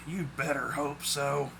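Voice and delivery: slow, soft grumbled threat; low-key, softened menace; groaned warning. A narrator's reading. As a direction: warn him slowly with a weary groan. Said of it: "Claude groaned as he stood".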